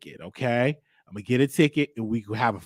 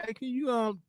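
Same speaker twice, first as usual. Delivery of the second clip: flirty ask; 6 semitones higher, 3 dB louder than usual. Retold or imitated. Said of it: "flirted with me a little bit and say".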